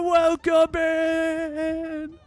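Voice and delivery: slow, loud and high sing-song welcome; drawn-out greeting. Real, not acted. Streamer hollers a slow welcome to Savannah joining. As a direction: stretch out the name like a host.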